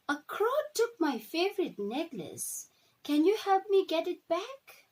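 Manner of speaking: put-on girl Alava begging Pocahontas for help, high and pleading; little girl voice. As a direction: plead like a small girl who lost her necklace.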